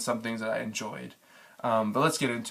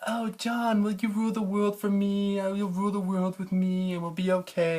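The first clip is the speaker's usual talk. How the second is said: much higher, sweet mock queen voice; higher-pitched parody of Dany seducing Jon; 9 semitones higher than usual. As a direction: coo it like a scheming queen, pitched way up. Mocking Dany's plea to Jon.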